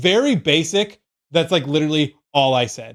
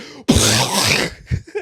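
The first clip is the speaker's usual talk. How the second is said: mouth-made blast of rushing air; imitated explosion noise; 3 semitones lower than usual. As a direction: puff out a big burst like an explosion. Imitates the sacrificial ritual blast.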